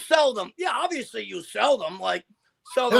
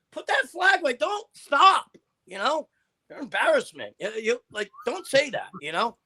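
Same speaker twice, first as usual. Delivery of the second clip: ordinary delivery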